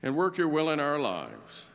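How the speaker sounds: low, measured prayer voice. Real, not acted. Closing prayer before the sermon.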